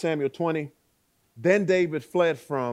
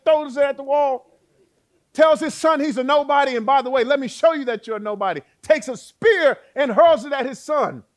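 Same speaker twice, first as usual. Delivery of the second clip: much higher, much louder, impassioned; preaching at full force; 12 semitones higher, 11 dB louder than usual. Acted. Preacher shouting Saul's banquet rage to the congregation. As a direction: let it ring out to the whole church.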